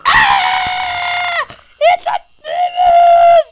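shrieking high in sheer terror; terrified scream. Real, not acted. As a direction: scream at the monster. Sees demon in maze.

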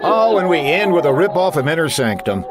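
ordinary delivery

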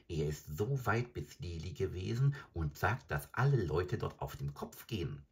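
ordinary delivery, nothing marked

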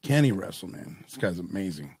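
normal talking voice

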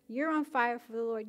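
normal talking voice

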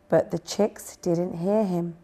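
plain reading voice

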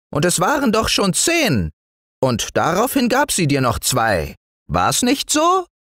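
very high, needling gnome voice; tiny know-it-all woodland man; scolding little dwarf. Acted. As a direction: accuse the boy in a piping voice. Dwarf figure cross-examining the boy.

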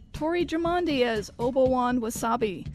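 ordinary delivery

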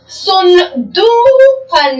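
صندوقا stretched out very slowly, syllable by syllable; careful, drawn-out صندوقا; slow model reading. Shown as a demonstration. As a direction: read صندوقا slowly for pupils to copy. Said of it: "الآن مثال آخر".

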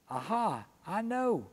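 a bright "aha" with "I know" leaping high; the penny drops, pitch jumps up; sudden realization. Retold or imitated. Job's sudden realization, voiced.

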